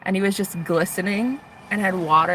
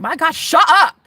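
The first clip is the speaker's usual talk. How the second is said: annoyed; 8 semitones higher, 9 dB louder than usual. Retold or imitated. Annoyed at loud roommate.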